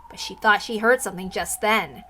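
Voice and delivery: ordinary delivery, nothing marked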